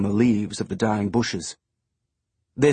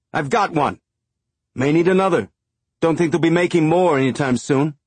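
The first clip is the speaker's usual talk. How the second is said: higher, louder wary call; shouted reply; 6 semitones higher, 4 dB louder than usual. A narrator's reading. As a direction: yell it back, guarded. Man shouting from house.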